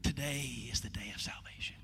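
solemn low whisper. Real, not acted.

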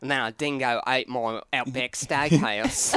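put-on Australian with rising inflection; Australian by way of New Zealand, upward endings; Australian accent. Shown as a demonstration. Host demos for guests, hammed-up Australian accent. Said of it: "How's your Australian accent?".

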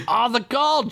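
high-pitched, insistent cry; urgent shout. A narrator's reading. Chickpea tugging at Duncan's duster, urgent shout. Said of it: "Chickpea shouted".

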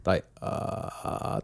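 drawn-out unvoiced ghostly hiss from behind; creepy whispered ghost noise; eerie breathy ghost whisper. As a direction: breathe it out slow like a ghost in your headphones. Spooky sound heard in headphones.

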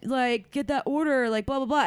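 high, laid-back surfer bro voice, pitched well up; surfer dude impression. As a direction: ham up a mellow California stoner. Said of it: "I'm really doing a terrible job".

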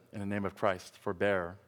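soft, low retelling of a cry; hushed retold shout. Knocked-down monk's last cry to the arena, retold softly. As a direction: bring the shout down to a grave hush. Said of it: "and he shouted".

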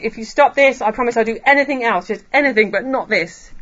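loud, hurried desperate bargaining; mock bargaining. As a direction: imitate frantic haggling, words rushing out. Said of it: "He's not bargaining. He's not saying".

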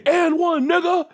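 high, loud crowing shout; triumphant trash-talk yell; dunker's taunt. Acted. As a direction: yell it like you just dunked on someone.

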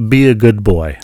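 loud, firm mock command; imitating bossy lady. Lady leaving admonishes husband, imitated loud command. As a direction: call it out like scolding a pet. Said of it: "back to the furniture store and said".

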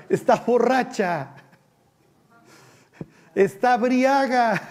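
mock accusing priest. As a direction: sneer it out slowly like a judging priest. Sacerdote juzga a Ana borracha. (sacerdote burlón, lento y agudo)

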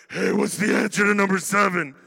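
a would-be whisper that comes out loud; failed whisper. Retold or imitated. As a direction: try to whisper but it booms. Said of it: "I am not capable of whispering".

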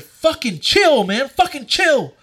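slow, high-pitched mock annoyance; retold pissed off. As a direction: re-enact an irritated demand, drawn out. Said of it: "I'm pissed off this point".

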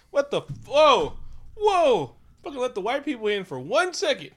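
high, loud mock exclaiming; shouted, indignant whoas; outraged alarm. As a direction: yell it in hammed-up disbelief. Acts Okoye's outraged alarm.

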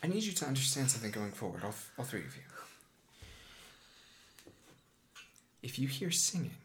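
lightly voiced, grave guide giving a warning; solemn magus. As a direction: warn them quietly, with long pauses before the danger. Game master voices the magus warning players.

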